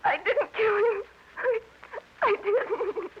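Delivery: crying, high-pitched protest of innocence; breaking down under accusation; tearful denial. Acted. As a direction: sob out the denial slowly.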